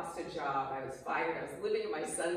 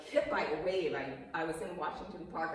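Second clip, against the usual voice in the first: usual speaking voice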